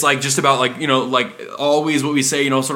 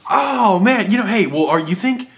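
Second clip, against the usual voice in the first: excited customer; 8 semitones higher than usual. Retold or imitated. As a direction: sound thrilled and eager.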